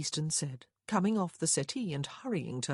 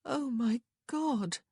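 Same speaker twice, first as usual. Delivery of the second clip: high, slow moan of dismay; 8 semitones higher, slower than usual. A narrator's reading. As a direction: moan it out, drawn long. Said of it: "Missus Easton moaned".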